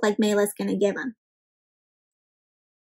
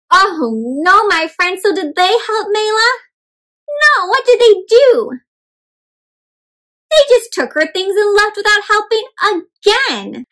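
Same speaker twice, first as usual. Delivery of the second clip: much higher, louder dismay; alarmed outrage; 8 semitones higher, 4 dB louder than usual. Real, not acted. Teacher to children, shocked and much higher.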